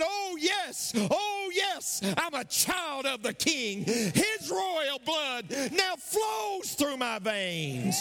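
high, slow triumphant proclamation; exultant shout. Acted. Preacher exulting to the congregation about being a child of the King. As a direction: ring it out in joy.

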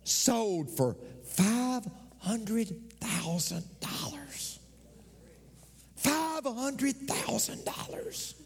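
slow, hushed amazed whisper; awed whisper. Acted. Preacher marveling to the church at the jersey price, hushed. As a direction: let the price hang in awe.

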